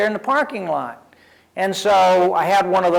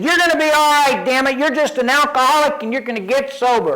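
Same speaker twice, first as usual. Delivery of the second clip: emphatic reassurance; 8 semitones higher, 3 dB louder than usual. Retold or imitated. Friend insisting he'll be fine.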